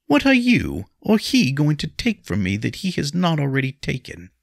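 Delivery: high, loud bitter challenge; defiant. A narrator's reading. Wife with nothing left to lose, defiant.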